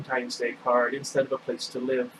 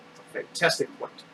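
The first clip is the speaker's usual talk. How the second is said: hushed aside; 3 semitones higher, 5 dB quieter than usual. Real, not acted. Narrator's aside on the story.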